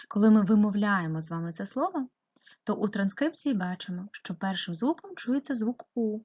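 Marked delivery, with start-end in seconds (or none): none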